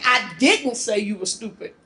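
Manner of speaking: "didn't" punched, rest falling away; stress on "didn't". Shown as a demonstration. Presenter shows class she meant to, stress on "didn't". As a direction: lean firmly on "didn't". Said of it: "I meant to, but I didn't".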